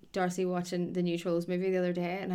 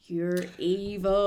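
mock accusation; much slower than usual. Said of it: "you're just like".

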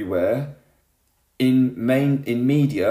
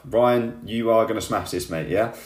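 ordinary talk, nothing marked

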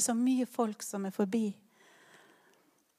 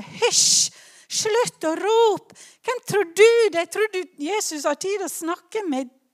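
high, loud scolding hush; 7 semitones higher, 6 dB louder than usual. Retold or imitated. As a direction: shush the shouting beggar sharply. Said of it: "folk ber han tie stille". (skarp, høy hyssing og skjenn)

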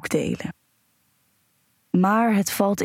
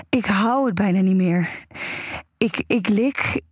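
aroused narration. Acted. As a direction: let the pleasure build as you tell it. Aroused first-person erotic narration.

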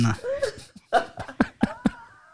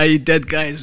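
ordinary delivery

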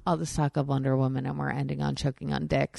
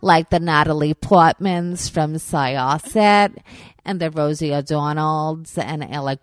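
put-on Long Island accent; 7 dB louder than usual. Naming local celebrities mockingly, thick Long Island accent. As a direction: list them in a broad, loud Long Island accent. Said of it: "That's the best fucking New York accent".

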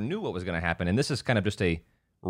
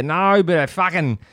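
loud, higher Australian comic impression; Australian accent; 5 semitones higher, 7 dB louder than usual. Host imitates the comedian's swearing, loud Australian accent. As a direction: burst out in a punchy Australian accent. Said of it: "the way he says fucking".

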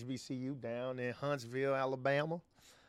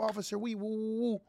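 high nervous plea; 9 semitones higher than usual. Retold or imitated. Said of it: "he put an accent".

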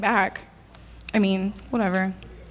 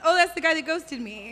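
usual speaking voice

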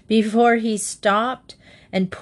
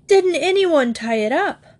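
indignant; 8 semitones higher, 3 dB louder than usual. A narrator's reading. Admiral upset at drifting yacht.

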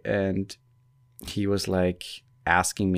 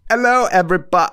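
high, loud, quick fake British greeting; mock British accent; 9 semitones higher, 8 dB louder, faster than usual. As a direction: greet them in hammed-up British. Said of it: "my British accent is far better than yours".

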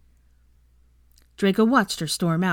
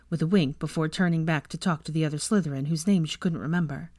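usual speaking voice

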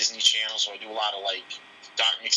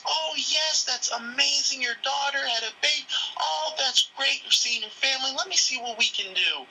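mock gushing agent; 14 semitones higher than usual. Gushing reservation agent voiced.

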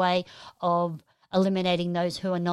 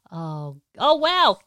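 delighted surprise; 6 dB louder, slower than usual. Real, not acted. Host reads of a viewer's new grandchild, delighted surprise. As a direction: burst out amazed.

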